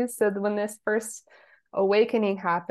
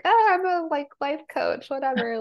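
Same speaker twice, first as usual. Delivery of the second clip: mock breezy; 8 semitones higher than usual. Mock casual self quote.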